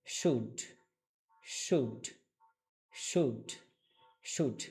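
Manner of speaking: "শুড" repeated with pauses, one falling syllable, silent L; pronunciation drill. Shown as a demonstration. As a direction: drill "শুড" slowly apart, no L sound. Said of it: "তাহলে উচ্চারণটা কি হবে".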